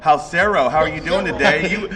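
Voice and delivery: normal talking voice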